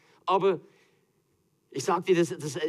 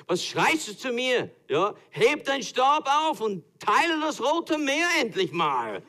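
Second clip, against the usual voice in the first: much higher, slow, impatient order; mock commanding God; 10 semitones higher, 4 dB louder, slower than usual. God ordering Moses to part the sea, hammed-up impatience. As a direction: tell him to get on with it.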